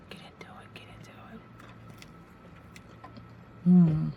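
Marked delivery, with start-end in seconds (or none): 0.1-1.4 hushed whisper to herself. Real, not acted. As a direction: breathe it out, no voice. Whispered to self eating.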